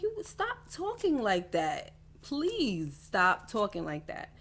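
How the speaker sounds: high, drawn-out plea sliding back down; exasperated plea. Retold or imitated. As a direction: beg them to stop, stretching the words. Acted plea to a friend.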